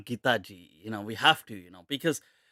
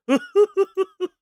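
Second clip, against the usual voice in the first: high burst of laughter; 6 dB louder than usual. Real, not acted. Cracking up at the clip.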